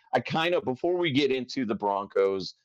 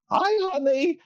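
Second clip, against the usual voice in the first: very high, louder comic mom; mom impression; 4 dB louder than usual. Host starting his mom voice, high caricature. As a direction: greet like a cartoonish mother. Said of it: "start the voice with that".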